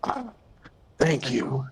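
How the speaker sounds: dry, crackly, flat voice; dry crackly monotone. Acted. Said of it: "Dry, crackly monotone".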